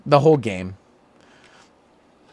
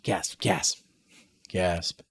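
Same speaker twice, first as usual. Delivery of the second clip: quiet mock gasps; 4 semitones lower, 8 dB quieter, much slower than usual.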